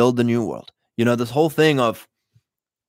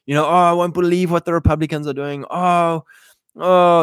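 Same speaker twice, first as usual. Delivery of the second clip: mock outraged victim; 5 semitones higher than usual. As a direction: ham up a hand-wringing news victim, pitched up. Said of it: "We don't watch the news and go".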